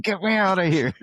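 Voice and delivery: a dog voiced as a desperate little person; dog's pleading voice. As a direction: voice the dog begging to be let out. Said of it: "Riley's like".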